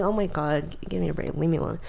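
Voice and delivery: telling accusers to leave her alone, dropped and soft; weary brush-off. Retold or imitated. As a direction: sigh it away, worn out. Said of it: "And I'm like".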